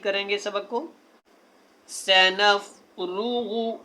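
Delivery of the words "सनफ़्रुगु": slow chanted verse with ringing सक़लान; model recitation. Shown as a demonstration. Teacher recites the verse so students copy it. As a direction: recite it beautifully. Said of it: "जैसे मैंने पढ़ा ना".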